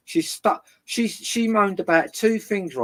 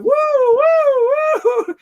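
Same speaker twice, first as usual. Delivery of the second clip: high, loud imitated siren; siren wail; 8 dB louder than usual. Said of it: "I was putting the siren".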